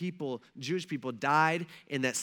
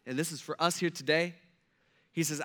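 normal talking voice

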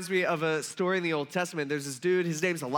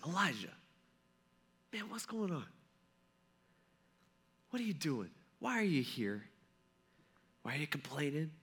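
God's voice, much softer, almost a whisper; little whisper; 3 semitones lower, 11 dB quieter than usual. A narrator's reading. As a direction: speak low and gently, as a still small voice. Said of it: "And then in a little whisper".

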